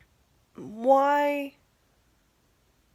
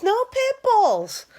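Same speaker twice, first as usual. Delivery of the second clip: high, slow drawn-out mock repeat; dragged-out parody of his rule; mocking the father-in-law; 9 semitones higher, slower than usual. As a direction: stretch it out, still high and mocking. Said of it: "He's like".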